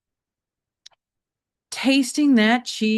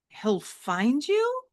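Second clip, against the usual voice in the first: slow, higher bemused mother; amused mom; 7 semitones higher, much slower than usual. Acted. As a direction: question it with gentle amusement.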